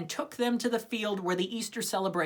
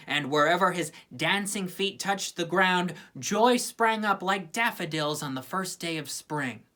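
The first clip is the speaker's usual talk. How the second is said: ordinary delivery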